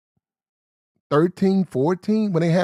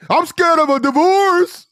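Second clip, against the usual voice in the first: much higher, louder, slow mock whimper; mocking whiny simp; 7 dB louder, slower than usual. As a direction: squeal it like a scared little boy.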